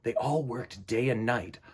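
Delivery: unhurried picture-book narration; storybook reading. Acted. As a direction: read it like story time for little ones. Reads picture book aloud.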